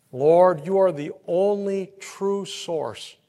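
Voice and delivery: ordinary talk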